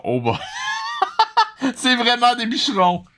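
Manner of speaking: normal talking voice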